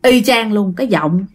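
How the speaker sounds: usual speaking voice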